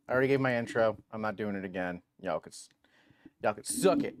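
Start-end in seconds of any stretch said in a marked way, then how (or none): none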